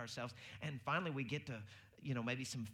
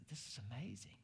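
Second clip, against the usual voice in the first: awed whisper; 6 dB quieter than usual. Retold or imitated. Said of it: "she goes".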